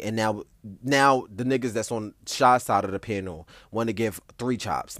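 ordinary talk

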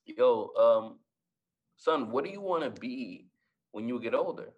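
normal talking voice

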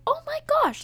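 very high squeal of amazement; retold awestruck delight. Seeing mother-in-law's party decor, high thrilled squeal. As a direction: replay being thrilled, way up high.

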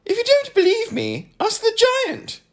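very high, loud character voice; piercingly high contrarian; high-voiced know-it-all. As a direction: pipe it up loud, lecturing with confidence.

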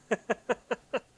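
high giggling laugh; self-amused string of chuckles. Real, not acted. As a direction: laugh at your own slip. Laughs at losing track of date.

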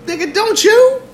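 high squeaky mocking put-on. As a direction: squeak it out like a caricature.

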